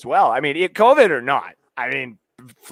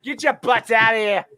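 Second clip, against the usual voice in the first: pitched-up Boston heckle; playful Boston fan taunt, easing off at the end; mock Boston accent; 6 semitones higher than usual. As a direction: jeer in a put-on Boston accent. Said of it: "trolled them with Boston accents".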